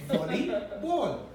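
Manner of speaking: ordinary delivery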